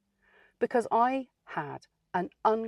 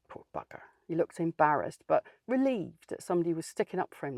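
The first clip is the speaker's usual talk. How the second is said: ordinary talk, nothing marked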